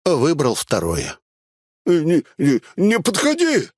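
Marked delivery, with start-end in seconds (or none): 1.8-3.7 stammered, high-pitched yell; panicked yell. A narrator's reading. Cornered bandit warding off the swordsman, yelling. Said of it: "заорал он".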